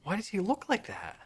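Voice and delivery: high, appalled reaction; shocked disgust at an ugly face; horrified. Real, not acted. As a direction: recoil at something creepy. Reacting to a creepy tape cover.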